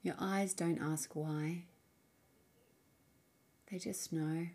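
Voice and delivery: soft, unhurried meditation voice with long pauses; guided meditation. Acted. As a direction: gently guide the listener inward, leaving space between phrases. Leads a guided mindfulness practice.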